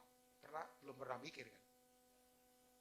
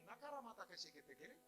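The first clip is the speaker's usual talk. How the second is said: raised-pitch pretend spirit language; mock speaking in tongues; 7 semitones higher, 5 dB quieter than usual. Visitor greets pastor at his house, mock tongues. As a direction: parody of ecstatic babble. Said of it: "menjawabnya bahasa roh".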